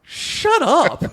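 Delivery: high, loud, laughing mock shout. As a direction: bark it like a cartoon grump. Parodying [name] shouting.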